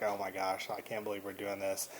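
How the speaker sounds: low, very soft aside; under the breath, dropped low; muted disbelief. Retold or imitated. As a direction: imitate someone quietly appalled. Voicing companion's private disbelief.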